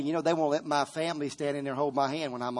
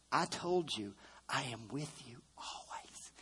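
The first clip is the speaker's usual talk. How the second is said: soft whispered refrain; hushed whispered reassurance; 3 semitones lower, 11 dB quieter than usual. Acted. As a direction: whisper it like a secret promise.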